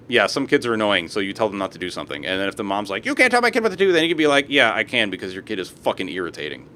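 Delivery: normal talking voice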